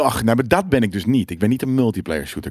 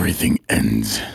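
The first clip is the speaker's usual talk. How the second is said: laag, langzaam en plechtig; dramatisch lage stem; 9 semitones lower, slower than usual. Acted. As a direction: zeg het als een filmtrailerstem. Dramatic English one-liner. (onheilspellende trailerstem)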